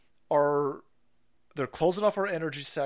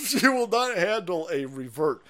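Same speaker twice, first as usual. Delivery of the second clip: higher, louder, through a laugh; 5 semitones higher, 6 dB louder than usual. Real, not acted. Host taunts the other side, laughing. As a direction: break up laughing mid-warning. Laughs while repeating his warning.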